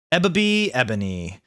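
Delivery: ordinary delivery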